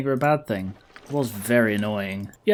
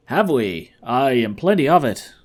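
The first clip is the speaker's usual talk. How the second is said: louder, hammed-up Irish brogue; Irish accent; 5 dB louder than usual. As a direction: answer heartily in a put-on Irish accent. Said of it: "gone Irish all of a sudden".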